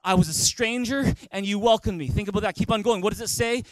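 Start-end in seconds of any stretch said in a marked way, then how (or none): none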